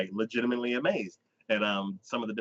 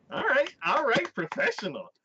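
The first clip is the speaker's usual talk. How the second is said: mock impressed; 12 semitones higher than usual. Mock reaction to her researching fish.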